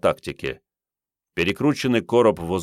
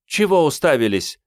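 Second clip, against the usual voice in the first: louder, higher snapped shout; barking; 9 semitones higher, 5 dB louder than usual. A narrator's reading. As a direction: bark at the gawkers. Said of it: "рявкнула спец по тактике".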